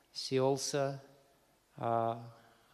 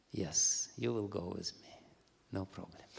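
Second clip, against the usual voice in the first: smiling; 7 semitones lower, 8 dB quieter than usual. Acted. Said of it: "he smiled".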